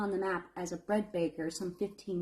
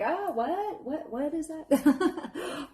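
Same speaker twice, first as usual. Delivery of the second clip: mock surprise; 6 semitones higher, much slower than usual. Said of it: "and I'm like".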